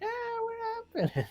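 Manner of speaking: imitated group shriek; mock screaming. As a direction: squeal it in drawn-out fake panic. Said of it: "screaming at the window".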